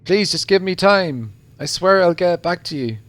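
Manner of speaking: mild mock wail. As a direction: beg for it, lightly wailing. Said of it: "Sudden wailing comes from the other side".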